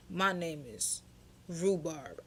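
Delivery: a hint of his voice, pausing before the name; older man. Man giving his name to a woman, lightly mimicked. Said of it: "He like".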